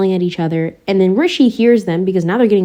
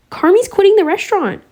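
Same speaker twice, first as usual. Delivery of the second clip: much higher, mock-tearful Sydney; upset, near tears; 12 semitones higher than usual. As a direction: lightly play her close to crying. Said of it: "upset and on the verge of tears".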